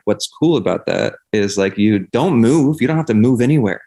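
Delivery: ordinary talk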